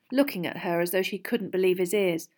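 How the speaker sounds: plain reading voice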